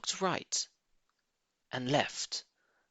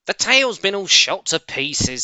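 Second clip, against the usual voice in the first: a touch of the bossy farmer's wife, louder at first; lightly voiced stern mistress; 7 semitones higher, 9 dB louder than usual. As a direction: mistress explaining from upstairs. Mrs Bean voiced.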